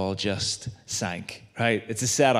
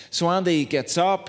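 ordinary talk

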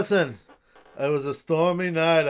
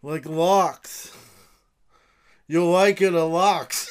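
pitchman voice; 6 semitones higher, 4 dB louder than usual. Pretend. Pitching the lox invention.

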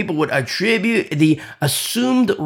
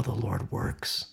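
whisper; 5 semitones lower, 10 dB quieter, slower than usual. Real, not acted. Dropping voice mid-sentence.

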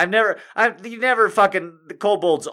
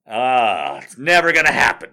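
usual speaking voice

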